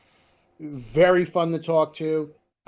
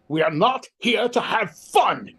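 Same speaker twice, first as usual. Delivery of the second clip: slow, higher, pompous stage-actor proclamation; Patrick Stewart, stern; 6 semitones higher, 3 dB louder, slower than usual. Retold or imitated. Actor scolding laughing castmates, slow and grandly stern. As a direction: declare it slowly like a grand Shakespearean actor. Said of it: "this is how he tells me the story".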